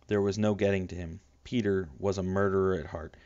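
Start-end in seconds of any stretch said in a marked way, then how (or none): none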